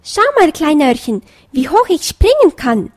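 put-on a small fox child bragging to be seen; little fox shouting to the bunny, look how high I jump; eager little fox calling. A narrator's reading. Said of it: "rief ein kleines Füchslein".

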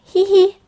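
high, loud read-out giggle; little acted bunny titter. A narrator's reading. Pieps amused by a singing rainbow, high giggle. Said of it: "lachte Pieps lautlos".

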